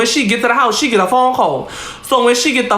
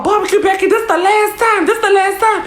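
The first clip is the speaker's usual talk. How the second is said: high-pitched mock yell, no real volume; retold scolding in a raised pitch; imitated yelling; 9 semitones higher than usual. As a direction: imitate a manager hollering a final warning. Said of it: "all type of yelling and screaming".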